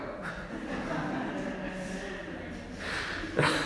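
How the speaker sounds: soft, high breathy laugh fading out; real laugh. Real, not acted. Priest laughing at his mother's phrase, soft laugh. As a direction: laugh helplessly at the memory.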